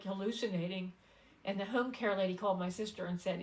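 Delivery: ordinary delivery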